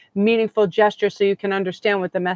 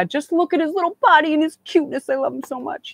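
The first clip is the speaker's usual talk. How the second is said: gushing adoration; 7 semitones higher than usual. Real, not acted. Gushing over the medium.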